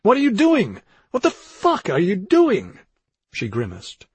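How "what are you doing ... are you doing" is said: high, loud outraged bawl; Daniel. A narrator's reading. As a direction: bawl it in horrified disbelief. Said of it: "while Daniel bawled".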